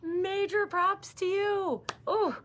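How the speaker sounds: high, drawn-out cheer; hearty praise. Real, not acted. Praising the viewer who body scanned, hearty congratulations. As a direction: stretch out the congratulations, high and slow.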